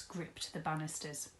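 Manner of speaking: ordinary delivery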